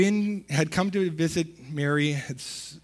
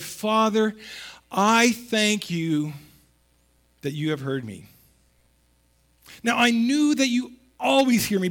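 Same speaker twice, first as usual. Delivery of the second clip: higher, louder, earnest prayer to the Father; fervent prayer; 4 semitones higher, 4 dB louder than usual. Acted. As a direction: pray it out with full conviction. Jesus's prayer read aloud.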